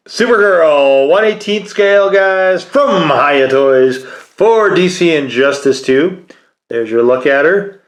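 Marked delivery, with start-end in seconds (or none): 0.1-6.3 announcer. Acted. Presents the figure announcer-style.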